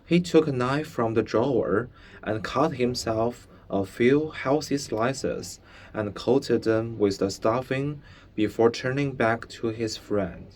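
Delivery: usual speaking voice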